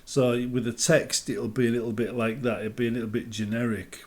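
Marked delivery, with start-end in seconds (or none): none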